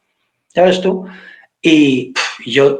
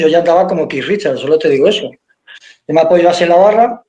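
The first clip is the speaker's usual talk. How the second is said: ordinary talk, nothing marked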